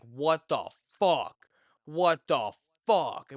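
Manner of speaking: slow, loud, stunned double curse; dad cursing in shock. Retold or imitated. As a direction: let the disbelief land on each word. Dad smells vomit in car.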